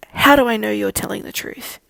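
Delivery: loud, demanding challenge; challenging. A narrator's reading. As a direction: call it out as a demand from the porch. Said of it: "the knight on the porch challenged".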